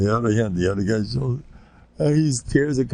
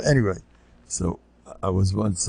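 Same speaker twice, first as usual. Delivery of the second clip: usual speaking voice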